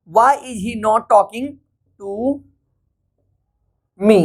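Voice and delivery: slow, with long gaps between words; slow classroom dictation. Shown as a demonstration. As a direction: build the sentence word by word. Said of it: "teacher building sentence slowly".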